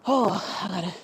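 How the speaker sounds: winded and panting; out of breath. Real, not acted. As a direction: talk while catching breath. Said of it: "I'm out of breath".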